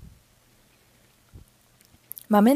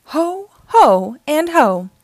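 higher, louder Santa laugh; jolly Santa ho ho; 7 semitones higher, 6 dB louder, slower than usual. Acted. Dad playing Santa to the kids, jolly ho ho. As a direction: boom out a merry ho ho ho. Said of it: "says Dad".

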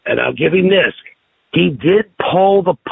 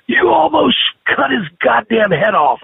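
retold yell; 9 semitones higher than usual. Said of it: "he looked at me and said".

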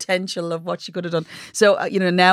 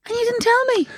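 shocked. Real, not acted. Shocked at friend's accident.

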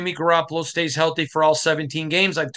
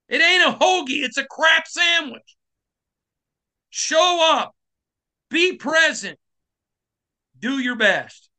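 angry shout; 8 semitones higher than usual. Real, not acted. Host yelling at the organization for selling fans crap.

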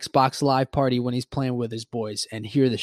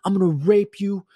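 high, mildly raised mock tantrum; re-enacted teen rage; 6 semitones higher than usual. Fifteen-year-old cursing out his buddy, mock furious. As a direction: rant like a pissed-off young gamer. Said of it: "I'm a fifteen-year-old and I said".

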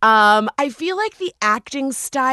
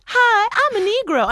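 chirpy voice pitched much higher; mock cheerful character; 12 semitones higher than usual. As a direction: play the eager new hire, overly bright. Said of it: "And she's like".

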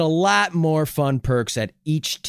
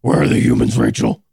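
Batman voice; 5 dB louder than usual. Acted. Batman voice bit about satellite.

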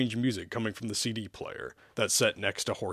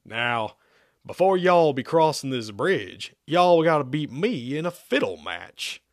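slow, raised hillbilly challenge; hillbilly drawl; 4 semitones higher, 5 dB louder than usual. A narrator's reading. Banjo man challenges travelers at bridge, hillbilly drawl. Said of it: "The hillbilly looked in awe".

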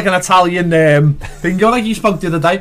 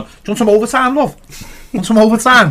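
mock offer; 6 semitones higher than usual. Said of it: "They'd gone".

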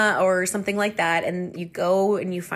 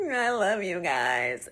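slow, hammed-up weepy affection; mock emotional; 2 semitones lower, slower than usual. Imitating friends' sweet, funny moment, mock weepy. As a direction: drag it out like a sappy sob. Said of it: "sweet and also funny that we're just like".

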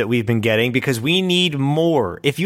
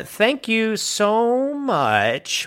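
drawn-out thanks; 7 semitones higher, much slower than usual. Real, not acted. Drawn-out formal thanks before a name.

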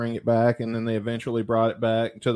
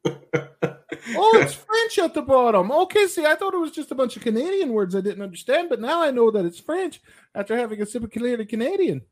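put-on Canadian accent; 9 semitones higher than usual. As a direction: play a cartoon Canadian, eh. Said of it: "Why am I talking like this all of a sudden?".

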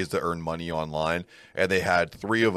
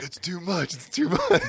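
mock whiny high voice; 11 semitones higher than usual. Mocking friend tapping out.

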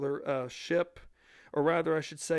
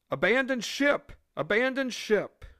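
loud, higher alarmed shout; shouting abandon ship; 8 semitones higher, 6 dB louder than usual. Retold or imitated. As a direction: yell it like a crewman calling the alarm. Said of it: "the crew was forced to do none other than the following".

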